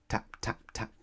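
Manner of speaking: soft, gentle taps. A narrator's reading. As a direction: tap it out timidly. Quiet knocking.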